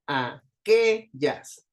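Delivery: aquellas very slow, with QUE punched; stress on QUE. Shown as a demonstration. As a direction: separate the syllables of aquellas, leaning on QUE. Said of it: "Suena más fuerte la sílaba de en medio". (aquellas muy lento, marcando la QUE)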